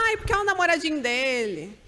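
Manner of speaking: mock-cooing parent; doting parent. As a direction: egg the toddler on sweetly. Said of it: "o pai com a mãe ... fala".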